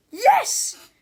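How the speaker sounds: loud, high yell; exasperated teacher's shout. A narrator's reading. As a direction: yell it at a pupil. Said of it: "yelled Miss Ribble".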